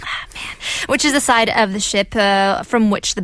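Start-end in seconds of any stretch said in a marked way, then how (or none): 0.0-0.6 whispered groan. Real, not acted. Embarrassed at asking needlessly.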